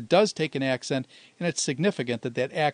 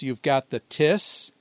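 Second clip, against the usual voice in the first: normal talking voice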